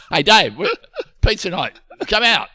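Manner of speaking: loud high call through laughter; calling Dave out to pizza night, laughing. Acted. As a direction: shout for him to hurry up.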